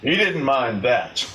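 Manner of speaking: ordinary delivery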